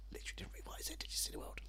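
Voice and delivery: whispered aside; whispering. Real, not acted. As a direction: whisper it under your breath. Aside muttered under breath.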